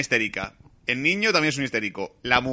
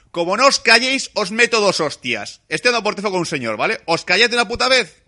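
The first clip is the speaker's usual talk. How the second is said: mock furious yell, much higher and louder; angry father yelling; 9 semitones higher, 7 dB louder than usual. Angry father yelling at his kids in the back seat. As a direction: turn round and bawl at the kids. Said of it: "el típico padre cabreado".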